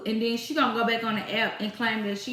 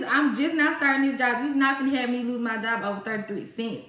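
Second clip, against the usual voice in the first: ordinary talk, nothing marked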